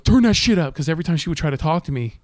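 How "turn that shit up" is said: higher, louder bark; mock demand. Boyfriend ordering the driver to turn up music, loud high bark. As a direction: order it loudly, pitched up. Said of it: "he goes".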